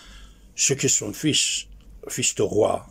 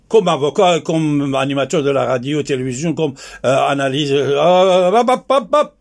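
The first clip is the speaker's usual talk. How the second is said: heated denunciation; 3 semitones higher, 8 dB louder than usual. Real, not acted. Speaker denouncing those who worked for ayatollahs, loud heated tirade. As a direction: hammer out each role at full volume.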